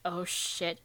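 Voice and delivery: a soft mock gulp of realisation; quiet dread. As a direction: drop to a hush of dread. Yuri realising quietly retold.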